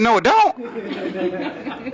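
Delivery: high, stretched-out comic protest; indignant denial. Retold or imitated. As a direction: drag out the outraged refusal. Indignant retort to girlfriend.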